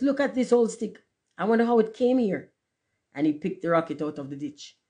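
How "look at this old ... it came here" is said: high-pitched wondering voice; boy's cry. A narrator's reading. Boy showing his friend the old stick, exclaiming. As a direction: exclaim curiously, pitched well up. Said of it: "cried one of the boys".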